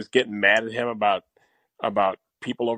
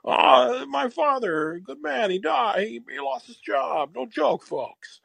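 put-on higher, slow folksy old man; Carvey-style Biden; 9 semitones higher, slower than usual. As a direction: lean into the slow no joke folks. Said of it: "Dana Carvey do his impression of Joe Biden".

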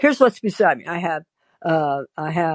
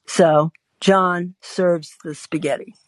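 ordinary talk

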